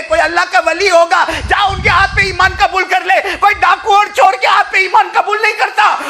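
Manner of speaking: high, loud impassioned preaching; fiery Urdu sermon storytelling; preaching at full force. Acted. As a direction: drive the story home at full cry.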